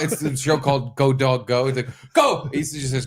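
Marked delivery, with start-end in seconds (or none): 2.1-2.5 put-on excited toddler cry. Toddler son voiced.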